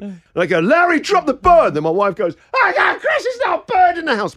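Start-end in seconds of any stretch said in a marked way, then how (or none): 0.6-1.7 higher, louder order to the cat; louder command. Retold or imitated. Owner ordering his cat to drop the bird, louder. 2.5-4.4 high, panicky mock cry; terrified wife. Wife terrified of birds crying out to her husband, high and panicky. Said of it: "My wife is terrified of birds".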